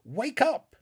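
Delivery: high, loud urgent cry; shaking a dazed friend, loud cry to wake up; cried out. A narrator's reading. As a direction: shout to snap him out of it. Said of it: "I cried".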